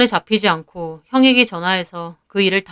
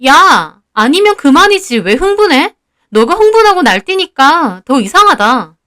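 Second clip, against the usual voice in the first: higher, louder indignant schoolgirl; heated retort, pitched up; arguing back; 6 semitones higher, 4 dB louder than usual. Acted. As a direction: fire back at a classmate who is overreacting. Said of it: "유지도 막 따지더군요".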